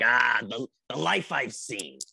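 lightly voiced weary old grandma, slow; a hint of a tired old lady looking back; dog's grandma voice. Owner voicing her old dog as a weary grandma. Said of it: "I like to think she talks like this".

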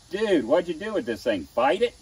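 hammed-up incredulous dude; mock disbelief. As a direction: rib him like a buddy gawking at a wreck. Teasing the student about mud.

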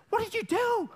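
very high, mock-horrified mother voice; scolding mom. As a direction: imitate an appalled mom catching her kid. Mother scolding over used towels.

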